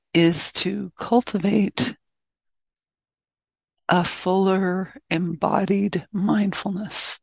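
calm, unhurried meditation guidance; short, even phrases with long silences between; guided meditation. Real, not acted.

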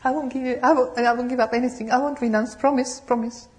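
put-on high, childish pleading voice; hammed-up high whiny ego. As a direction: beg like a stubborn child. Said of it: "But the ego said".

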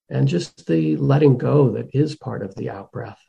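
calm meditation guide, long pauses between phrases; guided meditation. Real, not acted. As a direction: guide them gently inward, leaving space after each phrase.

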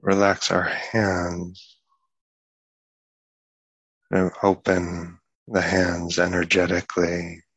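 unhurried meditation guidance with long pauses; guided meditation. Acted. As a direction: ease them into relaxing the body, one part at a time.

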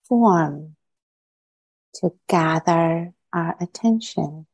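guided meditation. Acted. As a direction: ease listeners inward, unhurried.

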